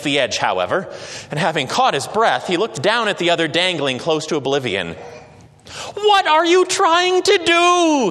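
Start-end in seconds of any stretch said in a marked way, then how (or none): 5.9-8.1 high, loud, slow yell of alarm. A narrator's reading. Man on the bridge yelling down at the other in alarm. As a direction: yell it down at the dangling stranger. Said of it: "he yelled".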